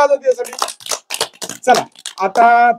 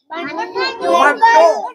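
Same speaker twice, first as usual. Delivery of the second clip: Bandu said with nose pinched shut, slow and nasal; pinching nose; 7 semitones higher, much slower than usual. Shown as a demonstration. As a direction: hold the nose closed and stretch Bandu slowly. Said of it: "नाक दाबून म्हणा बरं".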